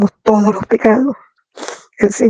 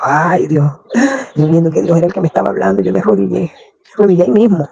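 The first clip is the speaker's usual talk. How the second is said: ordinary delivery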